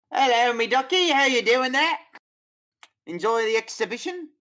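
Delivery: hammy Cockney barker greeting visitors; terrible Cockney barker. Acted. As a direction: bellow it like a bad Cockney showman. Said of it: "with a terrible accent".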